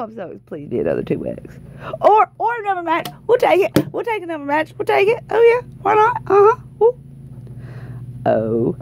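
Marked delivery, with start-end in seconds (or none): 2.0-7.0 high, giddy celebrating; bubbling over with delight; excited. Real, not acted. Celebrating the extra match.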